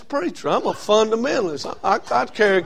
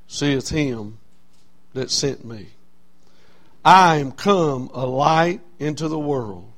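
slow, measured reading of the verse; scripture reading; 4 semitones lower, slower than usual. Acted. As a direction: read the Bible verse solemnly. Reads scripture slowly.

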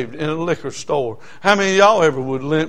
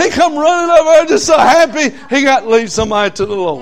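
high, loud, swept up in the telling; excited preaching; 11 semitones higher, 9 dB louder than usual. Real, not acted. Preacher telling the church about the happy song leader, excited. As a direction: preach it with rising joy.

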